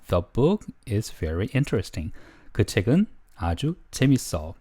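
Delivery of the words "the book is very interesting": very slow, word by word, phrase by phrase; slow English model sentence for learners to repeat; slow chunked reading. Shown as a demonstration. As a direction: break the sentence into slow clear chunks for students. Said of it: "이렇게 끊어볼게요".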